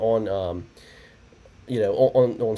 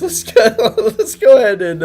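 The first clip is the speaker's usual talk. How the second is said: higher and louder, laughing; 12 semitones higher, 6 dB louder than usual. Real, not acted. Host moving on after failed calls, laughing. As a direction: chuckle while moving on.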